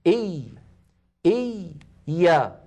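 "إي" held long, then "يا" released; split doubled ya. Shown as a demonstration. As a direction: hold the first ya of "إي" before opening into "يا". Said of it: "أنا أتكلم الآن على الياء الأولى".

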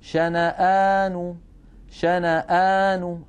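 شنآن with the long aa stretched slowly; recited madd. Shown as a demonstration. Teacher reciting Quran word to show madd al-badal. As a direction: hold the aa in شنآن for two beats. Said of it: "فتمد بمقدار حركتين".

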